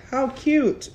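sweet voice. Retold or imitated. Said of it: "being like".

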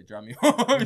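laughing at your own request; laughing through it. Retold or imitated. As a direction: laugh breaks into the words. Begging his friend for a ride.

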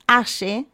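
Aye said with an Argentine sh; correct pronunciation. Shown as a demonstration. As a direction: say Aye as "A-she", slowly and clearly. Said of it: "para que lo pronuncien bien". (Aye bien pronunciado, con sh argentina)

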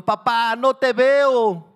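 put-on high, very loud panicked child; frightened wail with stretched words; desperate scream. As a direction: scream in terror for your father. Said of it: "con desesperación".

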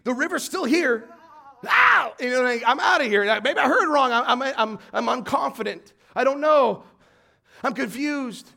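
doubting believer. Retold or imitated. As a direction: panicky believer giving up. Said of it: "because we're like".

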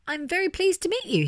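high, polite bear greeting; puzzled politeness. A narrator's reading. Puzzled bear meeting the hippo, high polite greeting. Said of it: "Albert LeBlanc looked puzzled".

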